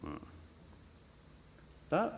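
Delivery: ordinary talk, nothing marked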